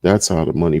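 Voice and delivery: usual speaking voice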